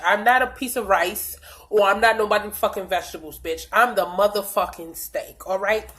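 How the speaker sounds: ordinary delivery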